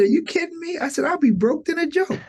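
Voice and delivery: you can't believe what God just asked; incredulous. Retold or imitated. Reacting to God's call.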